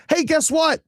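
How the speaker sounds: put-on high, sing-song gloating kid; mocking kid voice. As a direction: mimic a smug kid thrilled about no school. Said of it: "and it's like".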